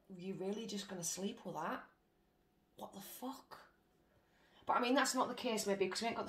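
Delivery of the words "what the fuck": disgusted mutter. Real, not acted. Grossed out by partner choice.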